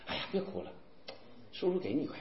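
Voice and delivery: very low, soft voice of a kindly passer-by; hushed consoling. Retold or imitated. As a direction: quietly soothe a crying child. Passer-by consoles a crying child. (低声哄孩子)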